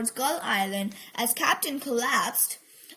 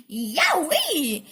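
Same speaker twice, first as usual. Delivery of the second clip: hammed-up ouch yell; drawn-out comic yelp; yelped yowee; 3 semitones higher, 5 dB louder than usual. A narrator's reading. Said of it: "he yelled".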